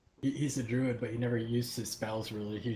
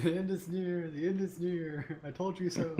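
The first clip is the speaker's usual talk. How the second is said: doomsayer beggar; 6 semitones higher than usual. Retold or imitated. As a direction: cry it like a street prophet. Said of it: "the town is, like, burning".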